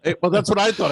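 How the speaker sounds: high, loud words through laughter; laughing along at a friend's joke. Real, not acted. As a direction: talk while cracking up. Laughing at the sneaker story.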